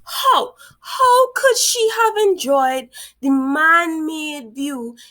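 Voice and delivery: how could she, really?; incredulous cry. Real, not acted. Outraged at the story's calm heroine.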